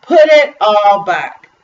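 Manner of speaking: loud, slow, firm order; stern father. A narrator's reading. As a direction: lay down the law slowly and loudly. Father orders candy returned.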